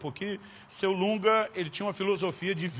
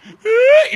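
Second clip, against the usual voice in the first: sudden squeaky frightened gasp; a child's choked-back sob; mock scared squeak; high yelp of fear; 16 dB louder than usual.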